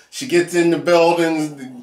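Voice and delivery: usual speaking voice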